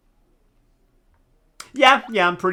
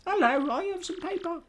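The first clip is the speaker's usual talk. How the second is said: squeaky silly voice. Acted. Mocking Mario's line.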